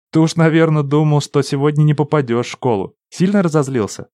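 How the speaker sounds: put-on bright, playful young girl; teasing girl. A narrator's reading. Lina teasing, higher and louder.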